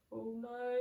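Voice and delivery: plain reading voice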